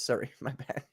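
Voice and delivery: light Canadian "sorry, my bad"; Canadian accent. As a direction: say it like a polite Canadian guy. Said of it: "I said it like a Canadian guy".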